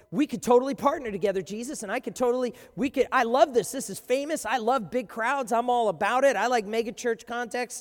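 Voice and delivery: loud, fast, high gushing; gushing scribe. Acted. Scribe pitching himself to Jesus, loud and gushing. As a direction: sell himself like a hyped fan.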